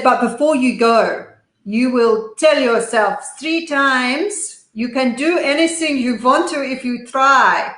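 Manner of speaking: a hint of her big Dutch father, spelling out the mantra slowly; thick Dutch accent. Dutch father telling his daughter to repeat the mantra, slow light Dutch accent. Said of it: "a very thick Dutch accent".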